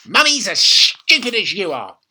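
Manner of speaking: grandma gloating about caterpillars; bossy grandma. A narrator's reading. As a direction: lay down the law, relishing it. Grandma insulting mother.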